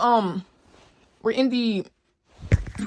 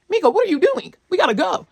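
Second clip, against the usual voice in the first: pitched up high, urgent, calling her to hurry; 9 semitones higher than usual. Retold or imitated. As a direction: rouse a friend pretending to sleep. Urgently calling friend to leave.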